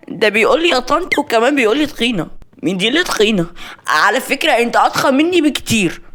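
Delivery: high-pitched, offended outburst; indignant complaint. Acted. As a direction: protest as if mildly outraged by an insult. Maria outraged at being called fat.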